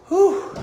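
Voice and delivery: a high puff of breath after sprinting; breathless whew. Real, not acted. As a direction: blow out air, winded from the run. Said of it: "Now I'm out of breath".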